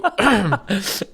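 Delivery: last choking cough, laughing; clearing the wrong pipe with a cough; coughing up water. Real, not acted. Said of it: "that went down the wrong pipe".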